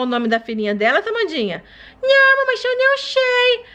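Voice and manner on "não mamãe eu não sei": put-on a sick little girl telling mom her tummy hurts; sick baby voice. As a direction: whimper like a feverish toddler. Baby doll role.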